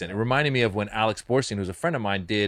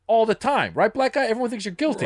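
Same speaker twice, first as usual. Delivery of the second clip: stand-up delivery; 11 semitones higher than usual. Retold or imitated.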